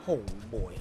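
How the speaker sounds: very low, soft murmur of dread. Real, not acted. Gamer sensing trouble ahead, low uneasy aside. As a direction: say it deep and small.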